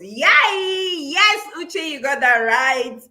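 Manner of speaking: high, elated shout of approval; whooping, delighted praise; excited cheer. Real, not acted. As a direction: cheer them on, voice soaring. Host cheers a viewer's answer.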